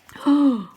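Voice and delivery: loud surprised gasp; real gasp. Real, not acted. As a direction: gasp at the picture. Reader reacts to picture.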